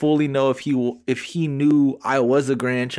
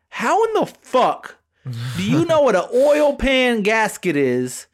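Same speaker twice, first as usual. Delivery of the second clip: incredulous; 8 semitones higher than usual. Real, not acted. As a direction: let the disbelief burst out. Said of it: "thinking to myself".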